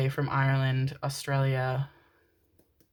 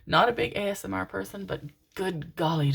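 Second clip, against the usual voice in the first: normal talking voice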